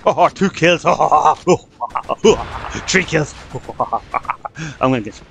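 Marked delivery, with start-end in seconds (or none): 0.1-3.6 gleeful laughing between the words; real laughter. Real, not acted. Gamer delighted at his kills, laughing. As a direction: crack up as the kills come in.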